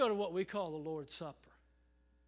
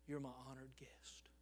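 soft unvoiced whisper; 8 semitones lower, 17 dB quieter than usual. Jesus to Judas at the supper, whispered tenderly. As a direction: breathe it gently like a secret. Jesus' words, hushed for effect.

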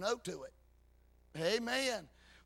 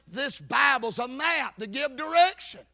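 loud, raised-pitch preacher delivery; full-force preaching; 4 semitones higher, 7 dB louder than usual. Real, not acted. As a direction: drive the scripture home loudly.

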